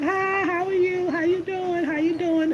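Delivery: lightly performed sunny hello; cheery greeting. Retold or imitated. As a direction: greet the porch neighbors brightly. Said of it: "I would walk by and be like".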